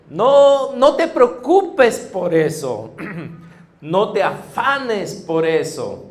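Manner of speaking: put-on a father gently urging his children to wake up; God as loving father. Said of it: "que Dios nos quiere decir".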